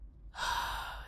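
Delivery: long breathy sigh, no voice; weary sigh. Acted. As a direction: let out a tired sigh. Bored captive sighs at officer.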